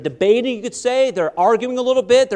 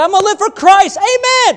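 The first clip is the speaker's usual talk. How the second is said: fervent vow; 12 semitones higher, 7 dB louder than usual. Acted. Quoting a young person's vow.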